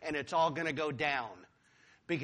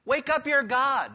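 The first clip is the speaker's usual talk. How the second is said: mocking taunt; 7 semitones higher, 4 dB louder than usual. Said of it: "He taunts them".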